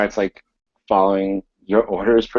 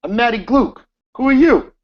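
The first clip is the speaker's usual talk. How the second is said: angry; 14 semitones higher, 5 dB louder than usual. Acted. Manager confronts gunman.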